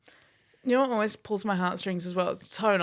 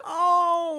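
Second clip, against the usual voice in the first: high, louder aww of pity; sympathetic oh; 11 semitones higher, 4 dB louder than usual. Retold or imitated. Reacting to lonely expat post.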